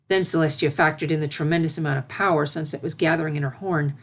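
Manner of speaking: ordinary delivery, nothing marked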